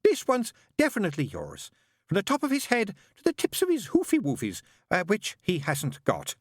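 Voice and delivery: high, bright-voiced chattering nun; fussy nun. A narrator's reading. Nun greeting the father in a high voice. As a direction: pitch it high like a flustered nun. Narrator voices Sister Mary.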